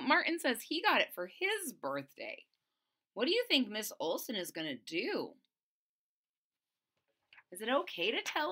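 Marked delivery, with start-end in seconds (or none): none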